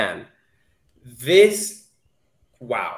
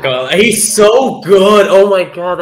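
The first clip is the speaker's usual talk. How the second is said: gushing; 4 semitones higher, 7 dB louder, slower than usual. Real, not acted. Gushing over the actor.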